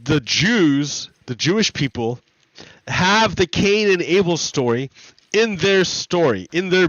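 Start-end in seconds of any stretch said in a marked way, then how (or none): none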